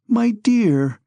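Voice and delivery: high, pleading appeal; imploring. A narrator's reading. Mother pleading with her reluctant son, imploring. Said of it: "exclaimed his mother imploringly".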